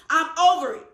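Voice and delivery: half-hearted yell of giving up; retold scream. To the dentist, pulling partials out, a loud mock yell. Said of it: "I screamed".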